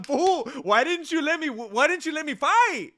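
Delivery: high, loud mock complaint of a beaten fighter; a hammed-up crybaby fighter whining to his opponent. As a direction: whine like a sore loser begging to fight. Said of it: "he's basically crying to him".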